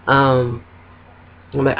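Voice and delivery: normal talking voice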